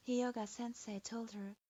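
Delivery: usual speaking voice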